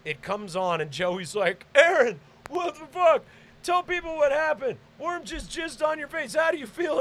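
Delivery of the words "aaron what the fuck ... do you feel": high, taunting interviewer voice; imitated friend shouting questions, pitched way up; excited heckler. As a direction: prod him with gleeful, high-pitched questions. Acted Joey prodding him on recording.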